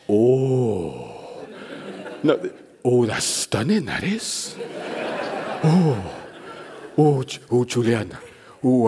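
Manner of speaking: overwhelmed hairdresser. Retold or imitated.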